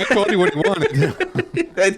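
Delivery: high, drawn-out laughing voice. Real, not acted. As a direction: squeeze the words out through real laughter. Talking through laughter at a joke.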